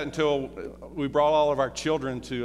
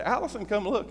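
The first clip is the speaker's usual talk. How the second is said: calling; 8 semitones higher than usual. Retold or imitated. Calling wife at Rockefeller Center.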